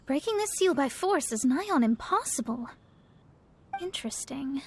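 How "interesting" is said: soft unvoiced whisper. Mona whispers, intrigued by the seal. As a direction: breathe it to herself, intrigued. Astrologer muses over the seal.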